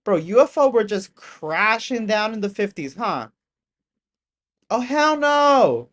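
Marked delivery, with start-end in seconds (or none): 4.7-5.9 high, loud, slow drawn-out protest; emphatic refusal. Real, not acted. Streamer recoiling from the footage, slow and loud. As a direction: stretch it out in horror.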